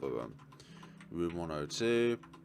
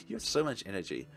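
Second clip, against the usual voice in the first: giggly; 8 semitones higher than usual. Acted. Giggling while sneaking.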